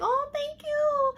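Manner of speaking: very high, slow, sugary idol imitation; sweet idol voice. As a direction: coo it like a delighted idol. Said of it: "and she's like".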